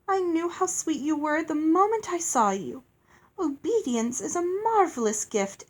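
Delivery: high, effusive lady, swooping pitch; gushing fairy. Acted. Fairy praising a girl's gift of obedience, gushing.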